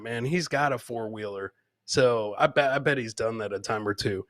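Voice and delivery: ordinary delivery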